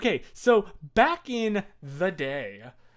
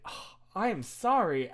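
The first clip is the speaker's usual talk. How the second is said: ordinary delivery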